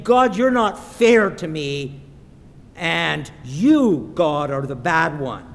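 loud, emphatic mock accusation; sulking complaint. Sulking church people blame God, loud mock complaint. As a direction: act out a sulker blaming God. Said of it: "They're sulking".

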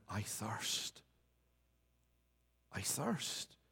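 soft, low, hushed cry of thirst. Acted. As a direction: let it fall almost to a breath, spent and weak. Christ's cry after I am list.

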